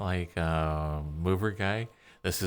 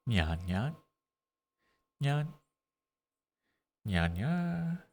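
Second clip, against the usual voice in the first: cutesy nya speak; 5 semitones higher, slower than usual. Shown as a demonstration. Trying the nya sounds.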